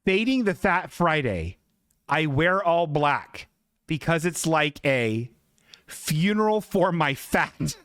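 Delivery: slowly reading each phrase; deliberate, drawn-out recital. Shown as a demonstration. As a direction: read it again, carefully. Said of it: "Let me read it again".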